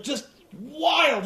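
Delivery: normal talking voice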